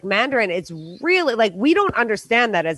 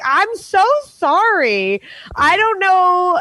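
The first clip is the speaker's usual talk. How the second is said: flustered apology; 8 semitones higher, 4 dB louder, slower than usual. Retold or imitated. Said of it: "I was like".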